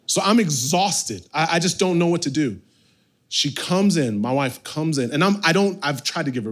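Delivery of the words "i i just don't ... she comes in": normal talking voice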